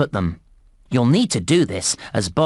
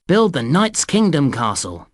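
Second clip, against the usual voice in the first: ordinary talk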